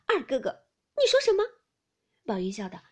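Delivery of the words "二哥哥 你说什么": affronted, leaping to a high, loud question; indignant, sharply rising challenge; stern offence. A narrator's reading. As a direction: take sudden offence and demand an answer. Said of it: "林黛玉当时撂下脸来".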